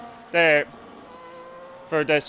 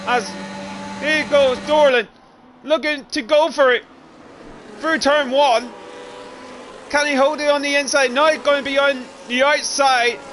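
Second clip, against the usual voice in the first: higher, building race commentary; excited play-by-play; 6 semitones higher than usual. Acted. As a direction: call the battle as it happens.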